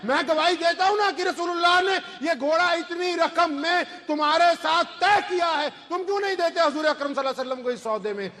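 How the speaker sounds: high-pitched, insistent testimony; forceful declaration. Acted. Companion bears witness for the Prophet before the horse seller, forceful and high. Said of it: "انہوں نے بڑی زور سے کہا".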